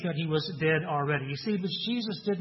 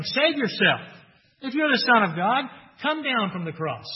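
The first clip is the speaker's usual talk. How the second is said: higher, louder jeering challenge; taunting crowd; 5 semitones higher, 4 dB louder than usual. Acted. Crowds jeering at Jesus on the cross, taunting. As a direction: taunt him like a mocking crowd. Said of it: "the crowds taunted Jesus".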